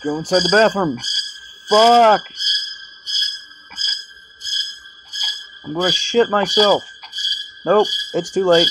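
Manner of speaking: loud, high panicked shouts; panicked yelling. Real, not acted. Player caught by the monster, panicked loud yelling. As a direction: yell in real terror as it chases you.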